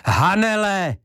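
high, loud shout, all his voice; full-voiced shout. A narrator's reading. Ivo shouting for Hanele in the silent house. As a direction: shout her name with everything. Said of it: "křičel plným hlasem".

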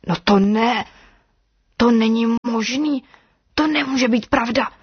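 disbelief building, pitch climbing to the end; growing astonishment; mounting disbelief. A narrator's reading. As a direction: realise the impossible, rising in pitch. Snake realises something impossible happened.

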